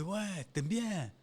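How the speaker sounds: put-on slow, high, drawn-out Italian accent. Hollywood adapting to the building chief, mock Italian accent. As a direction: imitate an Italian accent, stretching the words up high. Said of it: "Hollywood prend l'accent".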